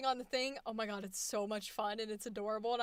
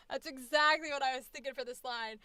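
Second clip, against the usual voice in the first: normal talking voice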